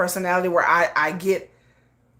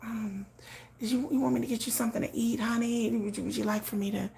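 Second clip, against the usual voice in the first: much softer, tender hushed coaxing; hushed sweet tones; 12 dB quieter than usual. Retold or imitated. Sweet hushed voice to her sleeping man Yoongi. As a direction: hush it down like tending a sleeping boyfriend. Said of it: "talking in hushed tones".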